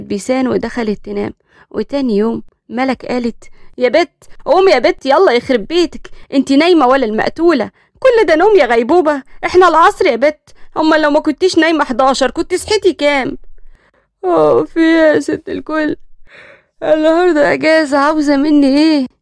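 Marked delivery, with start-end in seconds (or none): 3.8-13.4 loud scolding wake-up call, higher; mother yelling to wake her. Acted. Mother yelling at her lazy daughter to wake up, loud scolding. 14.2-19.1 a hint of a sleepy, whiny protest; groggy annoyed daughter. Daughter woken on her day off, mild sleepy whine.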